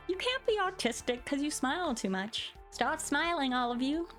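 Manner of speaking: ordinary talk, nothing marked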